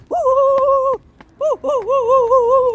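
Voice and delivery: high, repeated whoops; excited whooping. Real, not acted. Filmer whooping after spotting a dog, high excited whoops.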